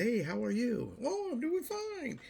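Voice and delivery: sing-song fake politeness; mock cheery greeting. Acting out passersby trading empty pleasantries, high sing-song. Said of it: "you walk and say".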